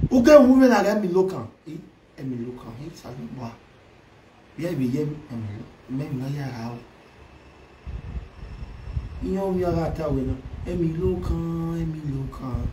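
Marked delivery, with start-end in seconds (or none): 1.7-12.6 soft, low, half-sung "emi lo kan"; quiet hook. Acted. Vlogger softly crooning the hook "emi lo kan", quiet.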